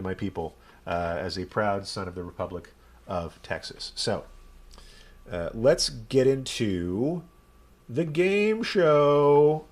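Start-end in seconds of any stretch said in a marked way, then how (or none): 5.5-9.6 drawn-out, loud, rising announcer call; game show announcer. Real, not acted. Host kicks off the game show, drawn-out announcer call. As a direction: stretch it out like a big show intro.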